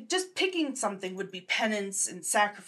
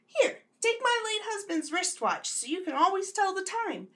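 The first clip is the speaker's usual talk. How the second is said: put-on old lady voice; 7 semitones higher than usual. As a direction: play a chatty church grandma. Grandma character voice in sketch.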